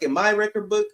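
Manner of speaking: ordinary delivery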